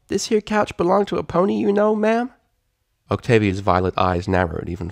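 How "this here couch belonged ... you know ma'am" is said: high, bright filly voice with a country lilt. Acted. Applebloom teasing the mare, high filly voice. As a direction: play a cheeky young pony.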